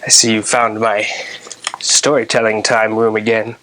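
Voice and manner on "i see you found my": ordinary delivery, nothing marked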